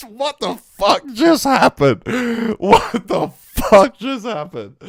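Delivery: high, slow, drawn-out amazement; stunned disbelief. Real, not acted. Streamer stunned by the drop for his viewers, slow amazed disbelief. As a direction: let the shock stretch every word.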